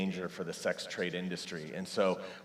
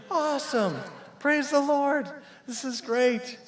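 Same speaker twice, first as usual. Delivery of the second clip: much higher, joyful burst; overjoyed praise, pitched way up; excited; 5 dB louder than usual. Retold or imitated. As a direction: cheer it like thrilled new parents. Celebrating pregnancy news.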